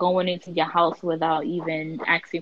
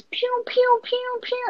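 imitated fireworks pops; 4 dB quieter, slower than usual. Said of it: "All I hear was".

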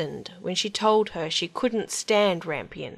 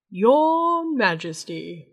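formal frog; 2 semitones higher, 4 dB louder, much slower than usual. Acted.

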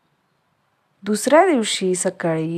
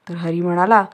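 plain reading voice

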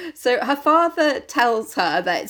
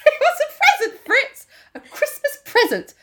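much higher, laughing protest; laughing high whine. Retold or imitated. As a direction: wail at Fritz between laughs. Marie scolding Fritz.

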